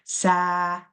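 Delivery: ordinary talk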